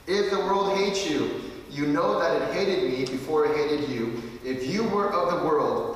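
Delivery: loud, forceful reading of the verses; emphatic scripture reading. Acted. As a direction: proclaim the verses to the congregation. Reads the scripture aloud.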